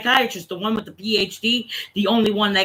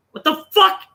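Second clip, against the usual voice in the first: retold outburst; 10 semitones higher, 3 dB louder than usual. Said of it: "I'm just like".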